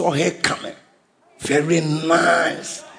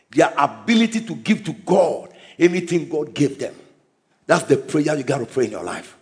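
normal talking voice

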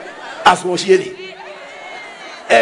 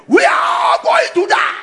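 much higher, loud cry of panic; retold panicked shout; 3 dB louder than usual. Pilot crying out as the plane crashes, panicked shout. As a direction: shriek it like a doomed pilot. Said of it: "He shouted".

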